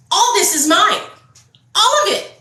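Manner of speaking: loud, high angry claim; retold anger. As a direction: re-enact a furious outburst, pitch leaping up. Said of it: "I got angry as hell".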